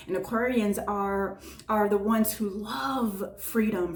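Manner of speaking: normal talking voice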